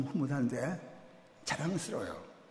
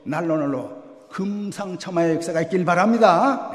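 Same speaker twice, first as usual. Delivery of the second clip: hearty blessing; 3 semitones higher, 8 dB louder than usual. Real, not acted. Blessing on the church.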